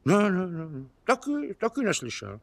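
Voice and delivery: high put-on voice, repeated denials; squirming, high-voiced denial; evasive denial. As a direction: fend off suspicion with high-pitched noes. Suspect denies hearing anything.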